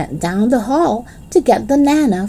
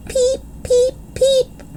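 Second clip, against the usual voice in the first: tiny squeaky chick peep, much higher and softer; teeny peep; 4 dB quieter, slower than usual. Acted. Lucille peeps in the dark, tiny and squeaky. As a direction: squeak it like a baby bird. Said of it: "I heard a teeny voice".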